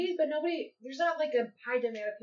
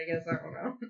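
usual speaking voice

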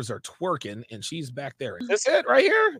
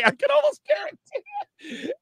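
squeaky falsetto. Acted. Exaggerated excited claim.

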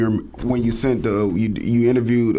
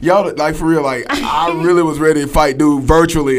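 louder, higher, laughing as it spills out; laughing excitedly; 6 semitones higher, 6 dB louder than usual. Real, not acted. Cohost recalls defending host online, laughing loudly. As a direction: crack up while telling it. Laughing over nearly fighting brother.

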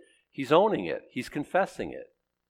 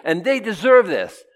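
higher and louder angels cheering you're right; eager agreement; 7 semitones higher, 5 dB louder than usual. Retold or imitated. Angels praising God's judgment, high and eager. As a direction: cheer God on. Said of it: "all the angels saying".

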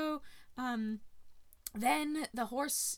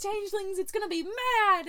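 higher and louder, rattled off fast; furious threat; 8 semitones higher, 5 dB louder than usual. Retold or imitated. King Brian threatens Darby, loud and furious. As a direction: spit the threat out like a raging little king.

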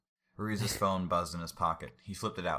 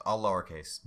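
ordinary talk

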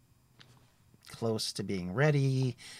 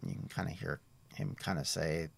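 usual speaking voice

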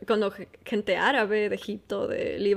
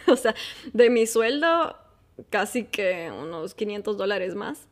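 usual speaking voice

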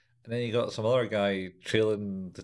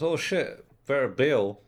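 higher, louder panicky exclamation; hammed-up fright, raised voice; mock alarm; 5 semitones higher, 6 dB louder, slower than usual. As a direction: imitate someone suddenly deciding to flee. Acting the man taking fright.

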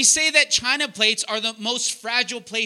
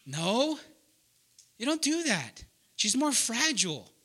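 usual speaking voice

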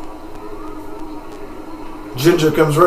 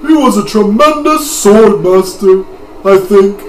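put-on old man voice; 7 semitones higher, 6 dB louder than usual. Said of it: "This is not the voice".

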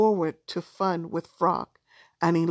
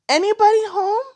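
high, loud call through the door; 13 semitones higher, 8 dB louder, faster than usual. A narrator's reading. Knocking on a door, calling.

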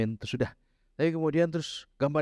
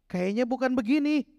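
much higher-pitched protest; mock doubting; 13 semitones higher than usual. Preacher voicing people who doubt God's instruction, high mock protest.

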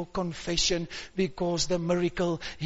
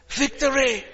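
shouting; 7 semitones higher, 4 dB louder than usual. Real, not acted. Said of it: "shout victory".

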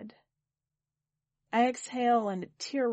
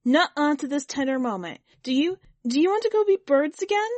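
loud plea climbing high; protest, rising into a desperate offer; 7 semitones higher, 9 dB louder than usual. A narrator's reading. Kit refusing the tender moment, loud protest. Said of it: "I protest".

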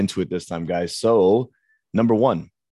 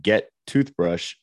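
slow two-word command with words left out; slow shortened command to a child; clipped toddler command; much slower than usual. Shown as a demonstration. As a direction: drop the little words, slowly. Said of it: "eliminating words".

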